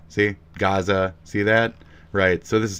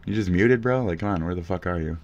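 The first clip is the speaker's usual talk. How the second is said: normal talking voice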